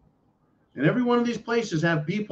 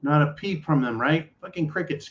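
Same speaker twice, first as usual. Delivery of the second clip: ordinary talk, nothing marked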